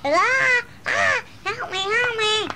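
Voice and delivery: mock cry for help. Child plays scared mouse.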